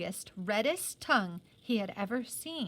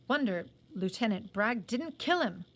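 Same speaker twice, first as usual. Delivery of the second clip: ordinary delivery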